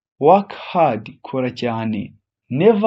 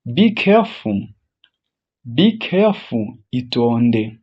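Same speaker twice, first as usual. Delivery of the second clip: usual speaking voice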